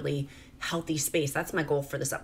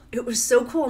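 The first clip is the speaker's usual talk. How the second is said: louder, brighter, leaning on so; excited emphasis; 6 semitones higher, 5 dB louder than usual. Real, not acted. Mom reliving the plane trip with delight. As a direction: push it up with delight.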